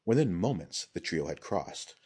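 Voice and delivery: ordinary delivery, nothing marked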